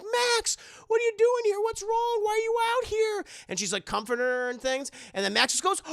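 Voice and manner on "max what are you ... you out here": high, raised worried voice; hammed-up frantic concern; acted panic. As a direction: fire anxious questions at the child. Frantic adult finds crying child.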